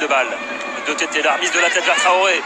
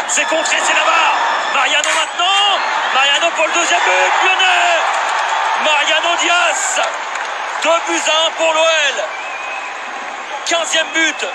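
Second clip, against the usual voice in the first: shouted goal call, higher; excited sports commentary; 8 semitones higher than usual. Real, not acted. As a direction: roar the goal out to the crowd.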